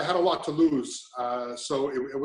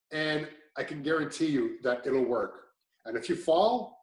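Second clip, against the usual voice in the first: normal talking voice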